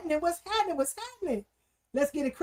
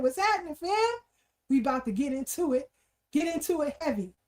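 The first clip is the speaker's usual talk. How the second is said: ordinary talk, nothing marked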